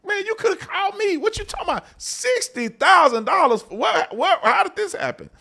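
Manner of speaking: high-pitched incredulous outburst; shocked disbelief. Retold or imitated. Boss hears from the vendor about the sixty thousand dollar bill, shocked high cry. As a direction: voice shoots way up in disbelief. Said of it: "I'm like".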